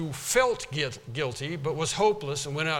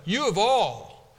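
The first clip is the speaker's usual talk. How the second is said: raised rebuke; 4 semitones higher, 6 dB louder than usual. Retold or imitated. Jesus rebuking Peter hypothetically.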